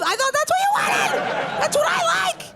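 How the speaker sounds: hammed-up innocent little voice; mock innocent squeal. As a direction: pretend you're sweetly confused.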